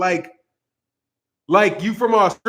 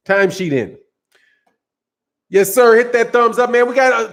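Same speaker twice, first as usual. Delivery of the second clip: usual speaking voice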